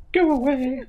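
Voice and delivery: high, loud tearful cry through a door; tearful shout. Acted. Sobbing Clarissa shouting at her visitor to leave. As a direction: shriek it through the door, crying. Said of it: "There's sobbing coming from a nearby cabin".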